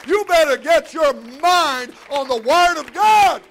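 forceful sermon, rising high and loud; full-force preaching. Real, not acted. Preacher warning church about true family, full-force preaching. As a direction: drive it home to the congregation, building.

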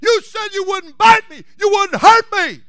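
high, loud shocked outcry; cowboy's high, loud, slow cry of betrayal; cried out. Retold or imitated. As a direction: yell it, betrayed and stunned. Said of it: "as he cried out".

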